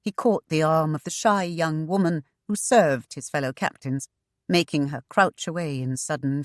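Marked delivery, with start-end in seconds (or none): none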